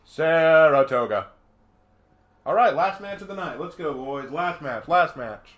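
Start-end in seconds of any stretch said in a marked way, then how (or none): 0.0-1.3 SARATOGA stretched out long and loud; drawn-out echo. Real, not acted. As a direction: savor "Saratoga" slowly and loudly. Echoes name, drawn out loudly.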